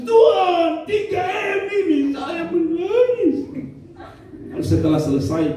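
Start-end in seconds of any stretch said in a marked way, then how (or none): 0.0-3.4 very high, stretched-out wail; exaggerated sobbing lament, held high; mock loud wailing. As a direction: escalate into a hammed-up widow's cry. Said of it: "dia tambah menangis".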